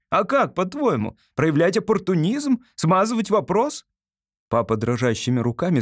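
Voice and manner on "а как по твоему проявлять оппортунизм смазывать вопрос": put-on high-pitched boyish indignation; indignant retort. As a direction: challenge your father with rising, outraged questions. Son indignantly retorts to father.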